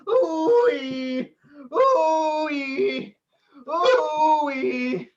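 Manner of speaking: hammed-up wailing ooh-wee; mock sobbing cries; hysterical crying. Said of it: "stop having hysterics".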